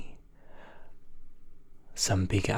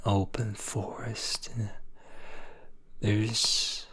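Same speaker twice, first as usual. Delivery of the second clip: ordinary delivery, nothing marked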